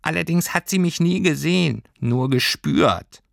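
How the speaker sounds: hohes Stimmchen, am Ende gedehnt; schränke ein als kleines Fabelwesen, ganz hoch; erklärendes Pelztierchen. A narrator's reading. Tierchen erklärt seine Vergangenheit. (hohe, bedeutungsvolle Tierchenstimme)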